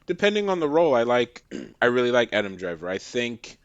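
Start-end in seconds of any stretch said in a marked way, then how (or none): none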